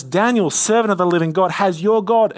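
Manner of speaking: high, loud anguished cry to Daniel; anguished call. A narrator's reading. King calling down into the lion's den, anguished. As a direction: call out in anguish, fearing the worst. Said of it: "he called to Daniel in an anguished voice".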